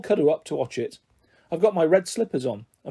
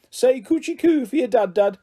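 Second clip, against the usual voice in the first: put-on baby talk; 7 semitones higher, 3 dB louder than usual. Dad baby-talking to child.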